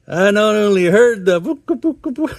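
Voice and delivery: high, loud cartoon old man; mock yell. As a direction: blurt it out indignantly. Grandpa yelling after seashell.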